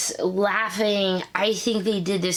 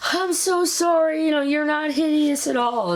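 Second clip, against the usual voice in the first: gushing apology; 9 semitones higher than usual. Retold or imitated. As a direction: overdo the sorry, pitched up high. Voicing Nate's apology.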